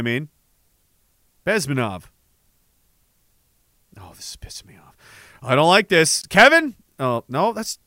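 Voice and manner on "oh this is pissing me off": whispered under his breath, annoyed; whispered frustration. Real, not acted. Searching for a lost video, he whispers irritably to himself. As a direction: hiss the complaint to yourself. Muttering while hunting for video.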